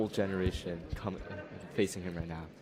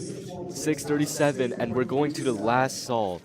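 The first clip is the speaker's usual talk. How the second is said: esports caster; 5 semitones higher, 6 dB louder than usual. Real, not acted. Live cube solve commentary.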